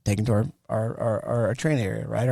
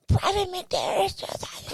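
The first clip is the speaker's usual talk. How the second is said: high, slow squeak fading to breath; strained recruit reporting; 7 dB quieter than usual. Retold or imitated. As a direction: report in a small mock-frightened squeak.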